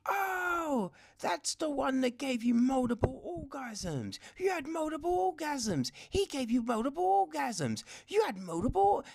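high, squealing girly mock voice; mocking the friend. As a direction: squeal it like an overexcited gossip. Mocking friend over the phone.

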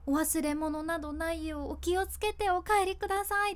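caring, meaningful 「お忘れ物などないようお気をつけてお帰りください」 with a lift on 帰; sincere, thankful closing line; heartfelt. Shown as a demonstration. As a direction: say it as if you truly care they get home safe. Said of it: "気持ち出すとそういう感じ".

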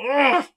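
high, disgusted ugh; exasperated groan. Real, not acted. Host groaning in disgust at the London error, exasperated groan. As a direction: groan in pure frustration. Fed-up groan mid-rant.

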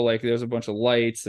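ordinary delivery

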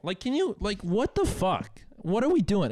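worked-up, stretched-out exasperation; exasperated. Real, not acted. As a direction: plead in disbelief, pitch way up. Venting at the rude women.

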